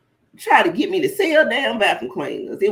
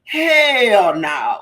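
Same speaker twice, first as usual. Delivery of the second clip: a louder drawn-out "hell no"; retold flat refusal; 3 semitones higher, 7 dB louder than usual. Guest quoting herself turning down the vacuum sales job, with attitude.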